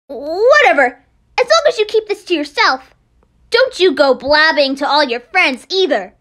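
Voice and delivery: loud, bitter rant at idols; loud grudging warning; resentful outburst. Acted. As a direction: lash out with contempt.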